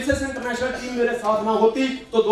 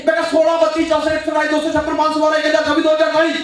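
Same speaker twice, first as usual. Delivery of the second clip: louder, higher, racing up the numbers; hammed-up hype at mom; excited shouting; 5 semitones higher, 5 dB louder than usual. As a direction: shout the figures with mounting thrill.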